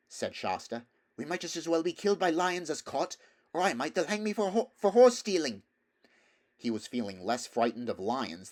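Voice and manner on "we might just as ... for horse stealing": put-on higher, quicker boy's voice; a worried boy pleading; anxious boy. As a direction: Shasta fearing capture, arguing with Bree. Shasta arguing with Bree.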